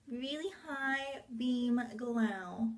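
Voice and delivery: drawn out slowly; slow lazy drawn-out talk; slow drawl. Real, not acted. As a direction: stretch every word lazily. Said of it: "I don't know why I'm talking like that today".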